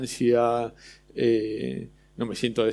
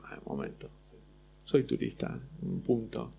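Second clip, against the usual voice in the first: mock whisper; 2 semitones lower, 11 dB quieter than usual.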